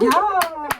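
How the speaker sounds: high, loud whoop; mock cheer. Reacting to the food bell, loud cheer. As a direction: cheer it out in imitation.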